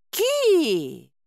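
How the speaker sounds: high drawn-out bird squeal; chick's cry. A narrator's reading. Chick offering its food to the heroine, high squeal. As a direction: squeal like a demanding baby bird. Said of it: "Птенец подкинул своё угощение".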